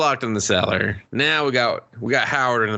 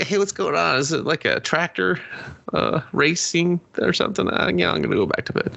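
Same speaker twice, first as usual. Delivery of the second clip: sleepy townsfolk voice; 8 semitones higher than usual. Retold or imitated. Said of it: "They're like".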